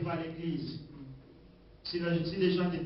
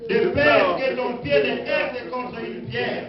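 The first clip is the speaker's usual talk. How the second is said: solemn scripture reading; 7 semitones higher than usual. Acted. Reads psalm aloud to congregation.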